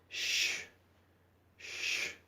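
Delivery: a voiceless sh, held twice; whispered sh. Shown as a demonstration. Teacher demonstrating the SC sound, whispered. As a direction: hush out sh twice. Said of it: "su sonido es".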